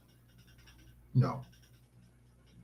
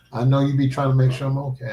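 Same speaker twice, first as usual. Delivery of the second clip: normal talking voice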